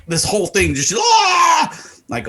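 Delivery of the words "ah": loud, high-pitched freak-out scream; hammed-up starstruck squeal; mock fangirl scream. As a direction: shriek like an overexcited fan. Friend's fit over seeing Barkley.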